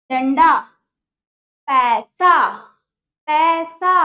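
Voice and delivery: पैसा said loud with a punched पै; loud classroom drill word; emphatic word demo. Shown as a demonstration. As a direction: hit the पै of पैसा hard. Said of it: "teacher demonstrates with emphasis".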